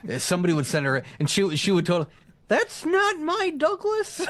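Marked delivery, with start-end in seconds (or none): 2.5-4.2 very high, drawn-out mom voice; hammed-up maternal protest; mock mom. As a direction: wail it out slowly as a mother, loud and high. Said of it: "would totally be my mom".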